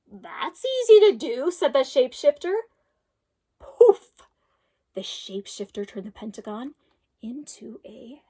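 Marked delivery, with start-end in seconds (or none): 0.1-1.5 higher, louder, slow showy promise; a grand, drawn-out magician voice; shapeshifter. Acted. Shapeshifter grants wish. 3.6-4.2 high, loud magic poof; a loud, high magical poof. Acted. Magic transformation sound.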